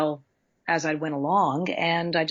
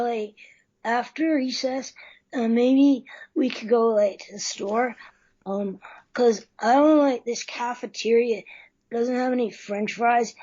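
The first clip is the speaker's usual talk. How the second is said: put-on lazy chubby boy asking for fries; 4 semitones higher than usual. Shown as a demonstration. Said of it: "that would sound like this".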